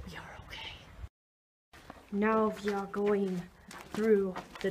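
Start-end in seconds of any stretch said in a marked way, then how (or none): 0.1-0.7 soft whisper. Acted. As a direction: whisper it like a secret. Whispered reassurance.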